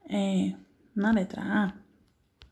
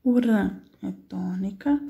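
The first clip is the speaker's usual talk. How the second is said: ran stressed, slow, louder; stressed syllable; 3 dB louder, slower than usual. Shown as a demonstration. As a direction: say O ran é tônica slowly, leaning on ran. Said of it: "ela é forte". (destacando o ran tônico, devagar)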